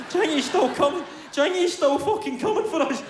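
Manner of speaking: put-on high, panicky child voice; terrified kid near tears. Crying kid in hiding.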